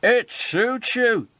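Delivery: slow, high-pitched teasing; laughing mockery. A narrator's reading. Henry makes fun of Whiff's name, amused mockery. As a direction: tease with amusement, drawn out and high. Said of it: "laughed Henry".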